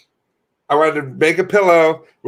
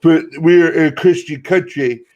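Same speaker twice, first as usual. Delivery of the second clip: louder mocking preacher-ish voice; 2 semitones higher, 4 dB louder than usual. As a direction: lay on a smug pious tone. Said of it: "they go".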